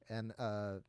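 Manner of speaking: ordinary talk, nothing marked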